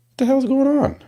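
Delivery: real fed-up disbelief; exasperated. Real, not acted. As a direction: throw it up in disbelief. Complains the show starts late.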